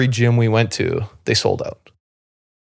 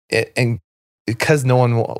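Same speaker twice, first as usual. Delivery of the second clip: normal talking voice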